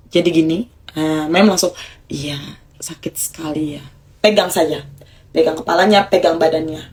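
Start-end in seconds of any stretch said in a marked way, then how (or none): 2.1-3.8 put-on whispered comfort to the child; soft whisper. Shown as a demonstration. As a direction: hush it almost voiceless to soothe. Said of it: "regulasi emosi pakai nada rendah".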